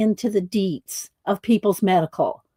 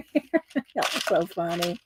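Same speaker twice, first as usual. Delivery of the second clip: usual speaking voice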